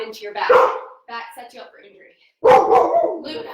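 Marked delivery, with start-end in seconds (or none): 2.4-3.4 high, loud call of the name; calling dog sharply. Real, not acted. Trainer calling her barking dog Luna, loud and high. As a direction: call the dog off mid-set.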